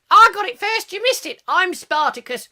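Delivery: high, loud hammed-up proclamation; playful mock cry of I got it first; mock Spartacus claim. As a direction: ham it up like a crowd claiming credit. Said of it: "No, I'm Spartacus".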